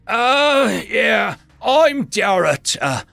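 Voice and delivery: big orc reciting. Acted. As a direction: rattle off names by rote.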